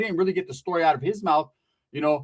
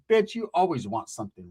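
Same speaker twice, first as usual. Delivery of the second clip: ordinary talk, nothing marked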